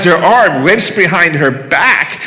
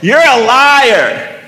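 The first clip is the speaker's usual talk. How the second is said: slow, much higher accusing cry; drawn-out, louder, higher shout; retold yell; 8 semitones higher, 4 dB louder, much slower than usual. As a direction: cry it out into the air. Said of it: "Melanie yells into the air".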